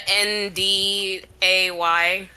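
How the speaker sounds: slowly spelled out, letter by letter; slow spelling. Shown as a demonstration. Explaining which letters cause the mix-up, slow and deliberate. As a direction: spell each letter clearly. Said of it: "spelling out letters slowly".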